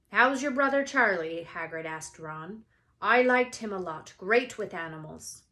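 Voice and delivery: change the subject brightly; lightly voiced Hagrid; Hagrid cheerful.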